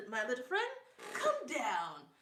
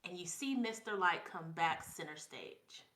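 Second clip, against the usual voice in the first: normal talking voice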